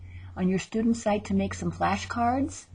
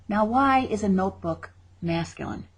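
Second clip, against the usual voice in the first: ordinary talk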